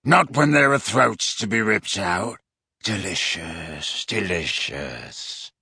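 low, slow, relishing rasp; savouring werewolf. Acted. Werewolf savouring the thought of blood, low and slow. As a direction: drool over the word delicious.

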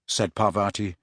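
plain reading voice

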